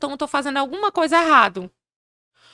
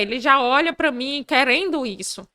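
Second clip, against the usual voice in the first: ordinary talk, nothing marked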